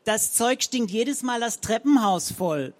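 scolding. A narrator's reading. Frau Zenker complains to Fräulein Rabenhorst, loud and shrill-pitched. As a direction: nag her like a house dragon.

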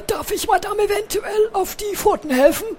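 hoch und galant fragend. Acted. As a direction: ein Kavalier, der sich verneigt. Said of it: "fragte er galant". (höfliche, galante hohe Stimme)

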